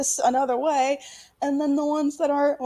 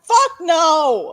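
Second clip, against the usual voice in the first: loud, blunt refusal; defiant fuck no; 4 semitones higher, 8 dB louder than usual. Real, not acted. Rejecting pleading with politicians, loud defiance. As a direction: spit out the refusal. Answering the mock plea.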